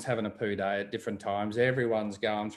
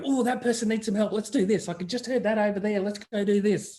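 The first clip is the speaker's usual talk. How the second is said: high, eager voice imitating the couple; excited; 8 semitones higher than usual. Friend imitates excited couple offering help, high and eager. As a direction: mimic their keen rush to help. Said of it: "get very excited".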